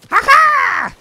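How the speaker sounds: loud, high-pitched evil cackle; villain cackle. Acted. Player attacks as the Emperor, high loud cackle. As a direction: cackle loudly at full tilt. Playing Palpatine in the game.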